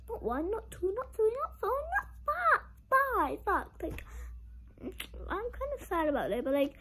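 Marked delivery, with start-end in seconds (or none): 0.1-2.6 rising count. Real, not acted. Counting up to five bucks.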